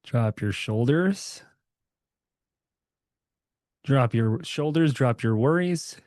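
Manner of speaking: calm, unhurried meditation guide; guided meditation. Acted. Guiding the group to relax their bodies, calm meditation voice. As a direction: ease the group into stillness, gently.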